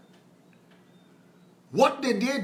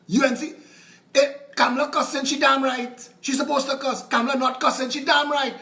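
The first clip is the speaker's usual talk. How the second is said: normal talking voice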